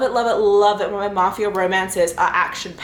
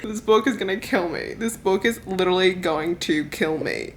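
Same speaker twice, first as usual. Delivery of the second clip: ordinary talk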